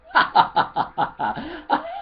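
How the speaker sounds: loud, high burst of laughter; big table-wide guffaw; hearty laugh. Acted. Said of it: "everybody at the table started laughing".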